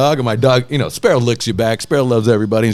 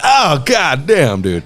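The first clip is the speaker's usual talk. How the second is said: slow mock amazement; much slower than usual. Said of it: "I immediately was like".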